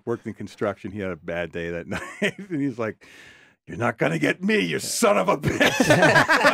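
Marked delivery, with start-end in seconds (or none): 3.7-6.5 loud, high, comic outraged yell; dad yelling at TV. Retold or imitated. Imitating dad cursing the TV ad, hammed-up angry yell. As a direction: build to a drawn-out furious mock shout. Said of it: "he would get mad and he would yell".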